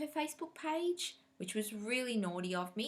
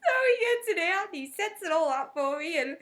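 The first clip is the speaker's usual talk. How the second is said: high and loud, laughing through it; can't stop laughing as she tells it; laughing retelling of the husband; 10 semitones higher, 10 dB louder than usual. Real, not acted.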